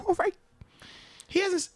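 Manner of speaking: ordinary talk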